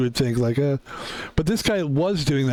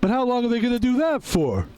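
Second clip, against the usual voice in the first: high, nasal-free mock whine, much higher; mock a critic in a squeaky high voice; mocking whiny voice; 9 semitones higher than usual. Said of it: "and he goes".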